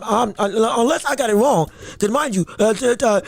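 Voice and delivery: normal talking voice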